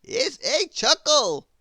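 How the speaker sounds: put-on a grand old wizard, astonished. The Wizard puzzled at Grizzle's noises, high wizard voice.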